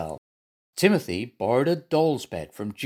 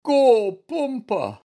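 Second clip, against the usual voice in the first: high, loud, feigned suffering; pitiful moan; 11 semitones higher, 6 dB louder, slower than usual. A narrator's reading. Bear pretends to be ill, pitiful high moan. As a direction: wail pitifully as a poorly bear.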